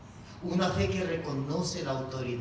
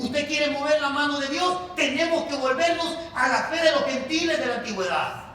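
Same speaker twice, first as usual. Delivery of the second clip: loud, high exhortation from the pulpit; full-force preaching; 9 semitones higher, 7 dB louder than usual. Real, not acted. Preacher calling the church back to ancient faith, full-force preaching.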